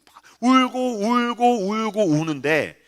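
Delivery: slow, drawn-out tearful telling; acted crying voice; imitated weeping. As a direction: stretch each word like a sob. Mimes weeping while telling of it.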